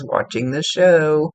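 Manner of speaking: slow, contented drawl; drawn out, like someone loving the show. Retold or imitated. Medium telling a client the father relaxes, said slow and content. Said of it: "he says".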